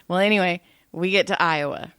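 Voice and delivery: ordinary delivery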